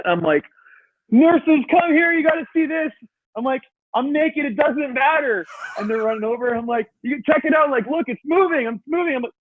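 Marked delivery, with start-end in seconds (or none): 1.1-9.3 much higher, thrilled calling out to the nurses; retold excited calling. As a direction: yell across the room, overjoyed it moves. Calling nurses to see toe move.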